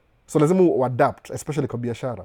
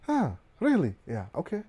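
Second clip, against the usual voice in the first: skeptical brush-off; 2 semitones higher, 7 dB quieter than usual. Retold or imitated. Acts own reaction to the comment.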